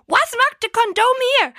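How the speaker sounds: mock mother. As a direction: put on a high nagging mum.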